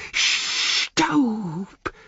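long hissed, high-pitched word; hissing Gollum. A narrator's reading. Gollum claws at the ash, hissing. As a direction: stretch the word into a creature's hiss. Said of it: "zischte er".

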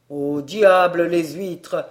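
loud, slow, fed-up cry; damn the oysters, loud and drawn out; exasperated outburst. Acted. Narrator shouting at the waiter, loud and exasperated. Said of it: "m'écriai-je".

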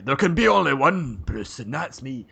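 a hammed-up movie Scottish accent; Mel Gibson Scottish accent. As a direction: do a Braveheart-style Scottish accent. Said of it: "What are you, Mel Gibson?".

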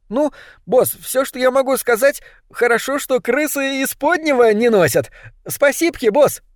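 high, squeaky little rat voice; grateful rat. A narrator's reading. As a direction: pipe cheeky thanks in a tiny high voice. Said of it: "поблагодарил сардины". (крысиный писк с благодарностью)

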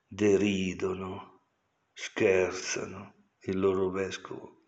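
usual speaking voice

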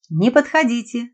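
high shout, voice breaking; cracking shout. Acted. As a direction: yell it with the voice breaking. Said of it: "срывающимся голосом закричал писатель".

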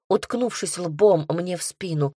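plain reading voice

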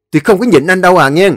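high, loud, fast warning; shouted warning. A narrator's reading. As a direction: warn him you'll hit back. Brothers quarrel over the house. (giọng hét cảnh cáo)

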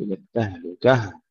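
التهلكة with the last ه pushed hard; over-pressed final ه. Shown as a demonstration. Teacher showing the error, التهلكة with forced final h. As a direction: stress the closing ه of التهلكة too heavily. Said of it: "لا نقول".

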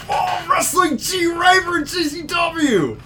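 high shout of excitement; excited shout. Real, not acted. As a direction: yell it out, thrilled. Excited announcement of a match.

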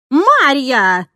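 enraged nanny shouting; furious shout. A narrator's reading. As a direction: yell at the tutor in rage. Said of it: "закричала она на меня".

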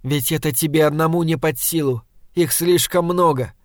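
ordinary delivery

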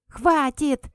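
loud high yell. A narrator's reading. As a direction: bark a sharp stop. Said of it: "заорал шнырь".

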